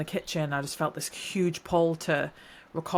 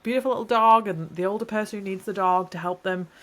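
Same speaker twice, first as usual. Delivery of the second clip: normal talking voice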